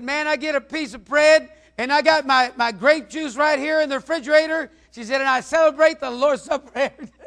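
high, loud, laughing gush; excited widow. Retold or imitated. Widow telling pastor about grape juice, excited and laughing. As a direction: bubble over with joy as the widow.

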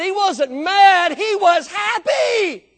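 slow, high, full-voiced yell; loud pulpit shout insisting on joy; comic insistent shout. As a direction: holler it, stretching the key words. Shouts that preacher was happy.

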